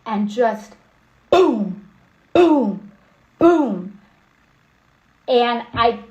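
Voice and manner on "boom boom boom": loud imitated booms. Imitating pounding on the door.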